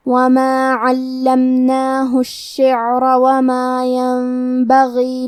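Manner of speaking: slow, melodic tajweed recitation; Quran recitation. Acted. Teacher reciting the verses in full for students. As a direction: recite slowly, drawing out the long vowels.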